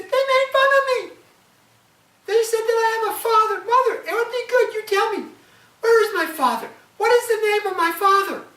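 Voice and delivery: put-on high, loud child's voice begging for an answer; pleading child. Boy asking his mother about his father, high pleading child voice. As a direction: beg like a hurt little boy.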